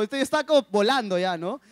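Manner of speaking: ordinary delivery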